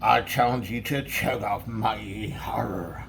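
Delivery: light pirate growl, slow; a hint of a pirate challenge. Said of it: "if Casey Patch".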